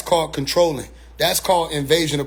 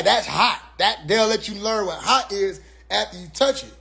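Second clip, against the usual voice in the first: usual speaking voice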